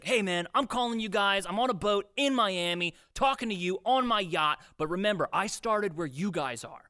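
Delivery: mock a boastful online sales guru; hype-meeting bragger. Sales boss addressing staff from his yacht, mock hype. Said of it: "like, a hype meeting".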